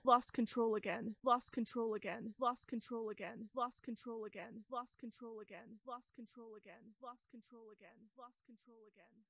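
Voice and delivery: fading echo. Acted. Echo's words dying into the cave walls. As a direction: let the words die away like a cave echo.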